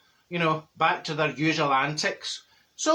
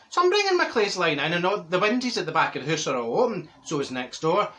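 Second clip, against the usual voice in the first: normal talking voice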